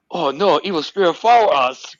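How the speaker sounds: high, loud hammed-up Chinese accent; hammed-up spooky warning in a put-on Chinese accent; mock Chinese accent. At the hotel joking to friends in a loud mock Chinese accent. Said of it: "I'm like".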